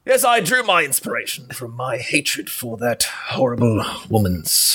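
mock British actor in interview; measured disdain in a put-on British accent; Peter Cushing. Old co-star confessing hatred in interview, mock-British disdain. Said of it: "interview of Peter Cushing emerges later".

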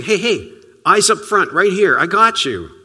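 rally him with a cheerful shout; encouraging call. Retold or imitated. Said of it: "It's almost as if he's saying".

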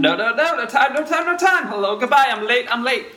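high, panicky little rabbit voice, squeaking out no time; a flustered rabbit who is terribly late. Acted.

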